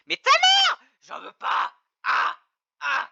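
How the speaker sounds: high loud furious yell; angry outburst. Real, not acted. Streamer yelling at the speech tool, furious. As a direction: explode in frustration.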